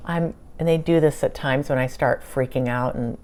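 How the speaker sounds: normal talking voice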